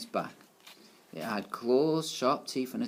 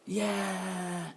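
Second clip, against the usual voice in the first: higher cheering crowd chant; roaring class; 8 semitones higher, much slower than usual. A narrator's reading. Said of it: "roared the class".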